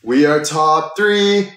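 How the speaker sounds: slow excited announcement. Real, not acted. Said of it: "Voice crack".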